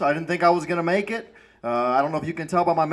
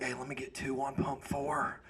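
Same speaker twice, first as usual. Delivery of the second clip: much softer, slow ashamed whisper; 3 semitones lower, 16 dB quieter than usual. Retold or imitated. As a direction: whisper the order so nobody hears. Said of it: "And you whisper it".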